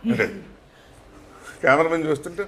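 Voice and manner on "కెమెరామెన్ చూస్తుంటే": ordinary talk, nothing marked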